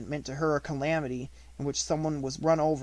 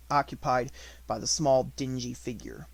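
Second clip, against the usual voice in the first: ordinary delivery, nothing marked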